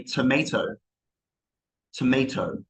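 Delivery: "tomato" with AY in the middle, tuh-MAY-toh; American pronunciation. Shown as a demonstration. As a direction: say "tomato" the American way but keep a crisp British T. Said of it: "but Americans will say".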